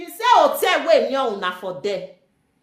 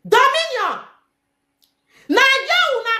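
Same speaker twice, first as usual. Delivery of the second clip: calling out; 12 semitones higher, 3 dB louder than usual. Retold or imitated. Calling out to rival woman.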